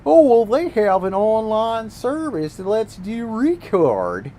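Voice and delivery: exaggerated know-it-all mock voice; mocking quote. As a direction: parody the fanboy excuse. Mocking a console defender.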